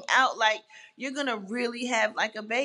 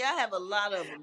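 ordinary talk

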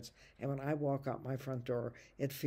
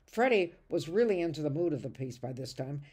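ordinary talk, nothing marked

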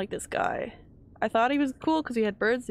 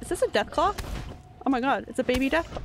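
higher, louder, fast excited gush; thrilled realization; 4 semitones higher, 3 dB louder, faster than usual. Real, not acted. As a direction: rush it out thrilled. Recognizing creature.

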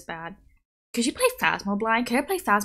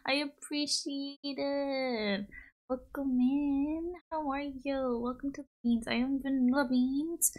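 follower greeting; 9 semitones higher, slower than usual. Acted. Streamer welcomes a new follower, high drawn-out greeting. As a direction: sing out a slow, high-pitched welcome.

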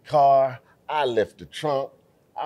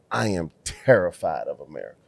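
low, deliberate delivery of the driver's words; retold Kenyan driver; 7 semitones lower, 3 dB quieter than usual. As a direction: quote the driver carefully, pitched down. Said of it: "I ain't gonna try to use his accent".

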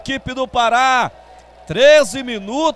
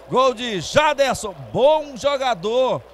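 ordinary delivery

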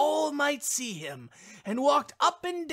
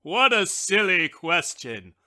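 scornful wishbone; 3 semitones lower, 6 dB louder than usual. A narrator's reading. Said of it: "replied the wishbone".

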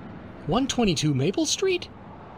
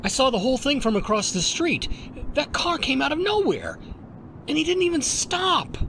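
agitated, high-pitched report climbing higher; shocked witness; 10 semitones higher, faster than usual. Acted. Witness telling cabbie about the car that didn't stop, shocked. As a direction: let the shock push the pitch up. Witness has just seen fatal accident.

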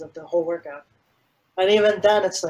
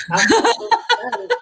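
sarcastic laughing excitement; 6 dB louder than usual. Retold or imitated. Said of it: "but I'm just like".

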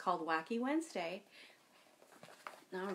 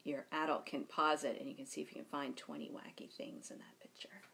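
whisper; 7 semitones lower, 7 dB quieter than usual. Real, not acted. Aside to children while reading.

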